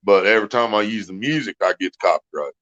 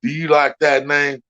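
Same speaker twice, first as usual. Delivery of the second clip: drill sergeant; 5 semitones higher than usual. Retold or imitated. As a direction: bark it like a Marine drill instructor. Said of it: "I've put the drill sergeant in a couple".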